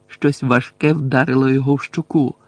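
plain reading voice

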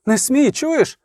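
high-pitched, mildly frightened warning; acted plea with a touch of fear; frightened cry. A narrator's reading. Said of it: "що ламався і тремтів від переляку".

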